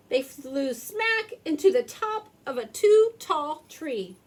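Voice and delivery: plain reading voice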